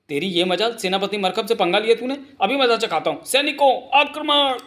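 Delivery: high, indignant threat rising to a battle cry; outraged commander. Acted. Commander ordering soldiers to attack, indignant rising shout.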